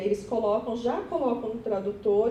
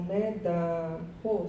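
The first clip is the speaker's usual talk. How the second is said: usual speaking voice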